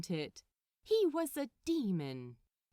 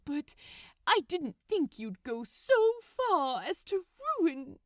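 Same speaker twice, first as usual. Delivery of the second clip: louder, higher, tearful accusation; hurt Aziraphale; 5 semitones higher, 6 dB louder than usual. Acted. Aziraphale accusing Crowley over the tree, louder and hurt. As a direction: accuse him, voice rising with hurt.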